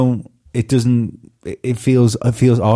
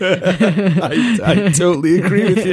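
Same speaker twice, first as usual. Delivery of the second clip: much higher, laughing as he agrees; laughing agreement; 10 semitones higher, slower than usual. Real, not acted. Host agreeing with the tease, laughing through it. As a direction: agree while still laughing.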